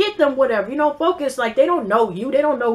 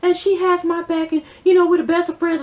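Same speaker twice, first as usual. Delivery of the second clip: mock gushing quote; 6 semitones higher than usual.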